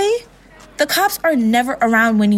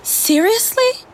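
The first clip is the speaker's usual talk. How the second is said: incredulous; 10 semitones higher, slower than usual. Acted. Friend balks at dispatcher's order.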